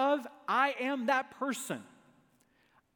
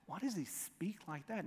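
hushed repeat; 3 semitones lower, 11 dB quieter than usual. Retold or imitated. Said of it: "Notice the questions they ask".

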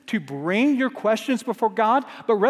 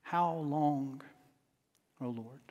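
much softer and lower, almost fading away; hushed lament; 6 semitones lower, 12 dB quieter, slower than usual. Acted. Preacher closing the lament before the congregation, hushed and spent. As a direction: let it sink to a spent, quiet plea.

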